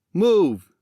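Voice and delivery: sharp loud command; barked order. Acted. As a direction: snap it out as an order. Jackson orders Max forward.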